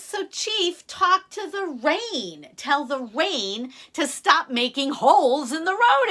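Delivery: high exasperated gecko voice; indignant gecko. Acted. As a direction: squeak it at the chief. Gecko demands chief talk to rain.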